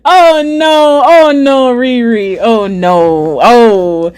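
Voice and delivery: hammed-up lament, drawing out each cry; mock wailing. Streamer lamenting what Riri did, mock wail falling away.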